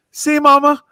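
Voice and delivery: high sing-song "See, Mama" then back down; mock-triumphant to his mom; teasing son. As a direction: son gloating to his mom, lightly voiced.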